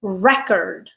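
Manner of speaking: "record" stressed up front, REC-ord; stress on first syllable. Shown as a demonstration. Teacher gives the other pronunciation, "record" stressed on REC. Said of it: "I can also pronounce this word as".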